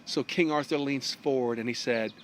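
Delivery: plain reading voice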